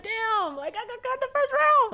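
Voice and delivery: very high-pitched mock wail; mock crying. Newly cut dancer telling mom the news, mock crying. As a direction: whine it out, imitating a tearful cry. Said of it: "I just start crying".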